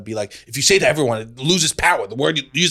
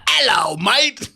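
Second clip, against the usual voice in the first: mock chipper greeting; 10 semitones higher, much slower than usual. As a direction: hail a mate with fake gusto.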